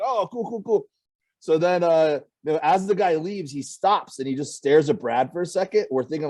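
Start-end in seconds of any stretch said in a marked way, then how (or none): 0.0-0.9 higher, soft and breezy; a hint of a casual old comic; old guy's mumble. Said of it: "He's like".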